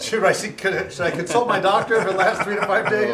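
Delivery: half-laughing joke question. Real, not acted. Joking about tingle lasting days.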